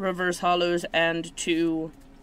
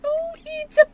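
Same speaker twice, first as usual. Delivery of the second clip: high, loud, drawn-out coo; delighted squeal; 14 semitones higher, 8 dB louder, slower than usual. Real, not acted. Card opener finding a baby card, delighted squeal. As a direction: gush slowly in a very high voice.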